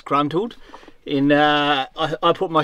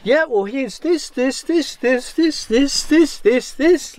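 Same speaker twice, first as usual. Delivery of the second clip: mock hand-over; 8 semitones higher, slower than usual. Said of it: "we could just be like".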